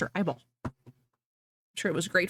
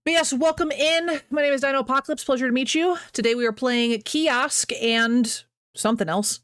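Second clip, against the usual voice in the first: stream intro; 6 semitones higher than usual. Acted. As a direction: greet the viewers like a show host.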